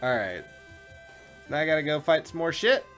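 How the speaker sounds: usual speaking voice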